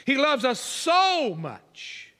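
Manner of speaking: slow preacher's climax on a long "so"; "so" stretched out with heavy emphasis; emphatic SO. Shown as a demonstration. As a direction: hold "so" high and long. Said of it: "It's an, an emphatic word".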